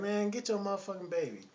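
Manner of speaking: nagging woman. Retold or imitated. Lady to the silent dad about the baby, mock nagging. Woman orders man, story retold.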